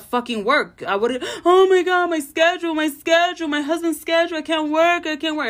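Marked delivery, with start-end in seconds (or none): 1.4-5.5 high, loud, panicky imitation of her old self; hammed-up panic over the schedule; mock freak-out. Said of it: "would've been freaking out".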